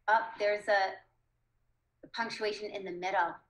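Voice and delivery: ordinary talk, nothing marked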